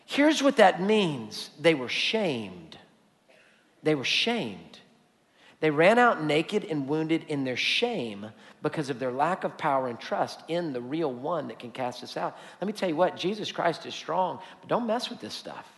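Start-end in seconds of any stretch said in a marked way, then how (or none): 1.6-4.8 grave and quiet. Real, not acted. Grave repeated statement. 5.6-15.7 low and much softer, grave; soft, serious warning to the congregation; hushed gravity. Real, not acted.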